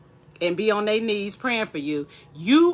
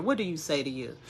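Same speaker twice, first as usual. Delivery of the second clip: normal talking voice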